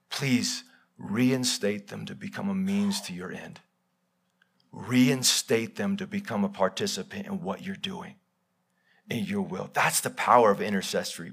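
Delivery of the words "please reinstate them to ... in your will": hushed intercession; pleading prayer. Acted. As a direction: plead quietly on behalf of the people. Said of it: "begs God".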